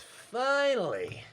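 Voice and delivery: high, long drawn-out "at last"; exasperated relief. Acted. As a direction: stretch it out after an endless wait. Relief that the rally is over.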